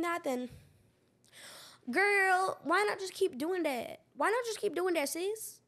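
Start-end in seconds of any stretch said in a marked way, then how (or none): 1.9-5.6 put-on high, sing-out girlfriend sass; sassy urging. Urging herself to keep making crazy TikToks, sassy. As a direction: coax her in a raised, sassy pitch.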